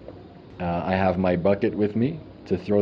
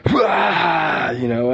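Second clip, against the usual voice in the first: retching strain; 9 semitones higher, 4 dB louder than usual. Real, not acted. Purging into the bucket.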